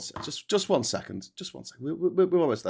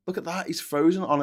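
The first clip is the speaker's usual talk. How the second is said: ordinary talk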